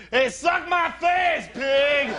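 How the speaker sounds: put-on high, slow drunken shout; hammed-up high drunk yell; drunk friend. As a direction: yell it at the cop like a sloppy drunk. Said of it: "drunk friend in the car next to you who goes".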